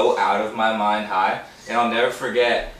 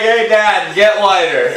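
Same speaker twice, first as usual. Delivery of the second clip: joking yell; 8 semitones higher, 8 dB louder, slower than usual. Retold or imitated. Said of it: "I was like".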